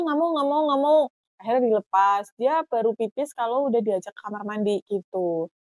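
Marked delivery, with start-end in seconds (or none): 0.0-1.0 put-on refusing toddler. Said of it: "dia nggak mau".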